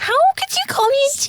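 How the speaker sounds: high mock offense. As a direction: pretend hurt, high pitched.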